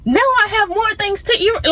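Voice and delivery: put-on excited kid thanking God for an eraser; mock excited thanks. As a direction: squeal with childlike joy over a small gift. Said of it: "And then you'd be like".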